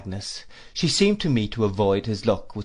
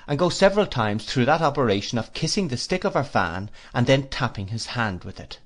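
usual speaking voice

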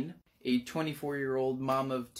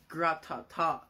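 mouth-made rapid gun rattle; imitated gunfire; 3 semitones higher than usual. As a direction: spit out a burst of machine-gun noise.

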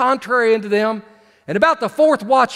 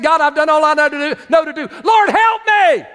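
desperate plea; 6 semitones higher than usual. Retold or imitated. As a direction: beg God for help, ending in a shout. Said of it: "when you said".

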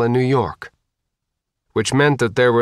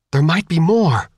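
higher, urgent plea; urgent insistence; 6 semitones higher, 3 dB louder than usual. A narrator's reading. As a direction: insist there's still hope. Pleading to keep waiting for passengers.

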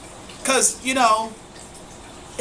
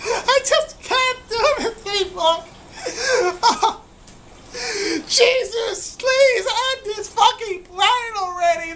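high laugh-sob, pleading to the sky; laughing crying; 9 semitones higher than usual. Real, not acted. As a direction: crack up and beg for it to end. Breaking down laughing at clip.

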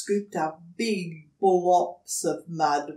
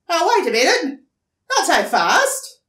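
higher, loud indignant stop; bristling echidna; 10 semitones higher, 11 dB louder, faster than usual. Acted. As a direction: bristle and cut them off. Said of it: "he bristled".

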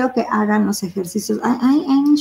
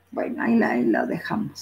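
muttered aside; 4 semitones lower, 6 dB quieter, faster than usual. Real, not acted. Teacher mutters aside to herself.

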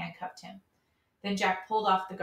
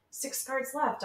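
higher-pitched called-out count; shouted warning; 6 semitones higher, slower than usual. A narrator's reading. Austin calling out the guard count, higher pitch. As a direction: call it out across the room. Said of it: "Austin shouted".

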